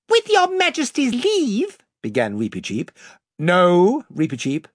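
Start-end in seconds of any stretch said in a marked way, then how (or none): 0.1-1.8 very high, squeaky mouse voice; a tiny mouse knight, very polite; brave mouse voice. A narrator's reading. Reepicheep the mouse speaks. 3.4-4.7 firm and commanding king. A narrator's reading. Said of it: "said the king very firmly".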